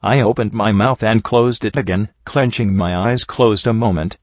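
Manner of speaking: ordinary delivery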